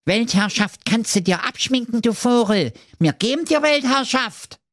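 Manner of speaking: high, taunting call; mock the wizard while thumping him; jeering dwarfs. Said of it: "riefen sie".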